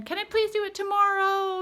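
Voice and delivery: high pleading whine; whiny plea. Retold or imitated. Son begging mom to delay math, whiny. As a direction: beg like a whining child. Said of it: "Like whining, crying, fussing".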